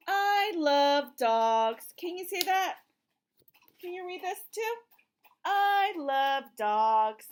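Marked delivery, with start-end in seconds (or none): 0.1-1.9 very slow and loud, each word spaced; slow read-aloud. Shown as a demonstration. A mother reading aloud, slow and loud. Reads refrain slowly. 5.4-7.3 very slow and loud, each word spaced; slow read-aloud. Shown as a demonstration. A mother reading aloud, slow and loud. Reads refrain slowly.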